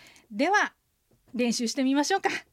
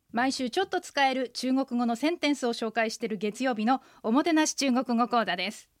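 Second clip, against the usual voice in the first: ordinary talk, nothing marked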